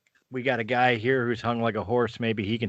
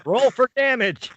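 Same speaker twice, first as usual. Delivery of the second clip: high, loud mock announcement; raised, high-pitched cry; gleeful call-out; 13 semitones higher, 8 dB louder than usual. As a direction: shout it gleefully over the table's laughter. Game master jokingly calls for roll.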